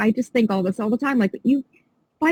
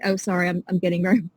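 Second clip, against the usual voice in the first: normal talking voice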